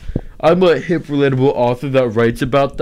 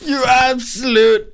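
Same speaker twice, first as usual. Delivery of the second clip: put-on a light Scottish accent, slow; hammed-up Scottish insult; 12 semitones higher, slower than usual. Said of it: "that's Scottish".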